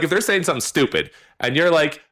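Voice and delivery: ordinary talk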